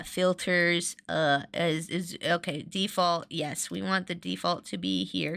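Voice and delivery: ordinary delivery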